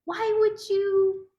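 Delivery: high, slow pleading cry of why; pleading to Allah. Retold or imitated. Woman retelling her complaint to Allah, high pleading.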